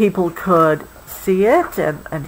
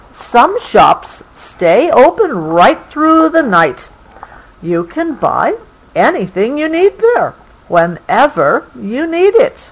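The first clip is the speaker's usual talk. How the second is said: story reading; 5 semitones higher than usual. A narrator's reading. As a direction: read it wonderingly to a child. Reading book text.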